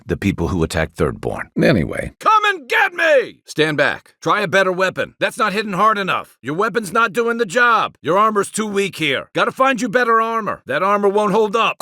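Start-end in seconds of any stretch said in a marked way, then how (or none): 2.2-11.8 combat barks. Acted. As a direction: bark it across the battlefield.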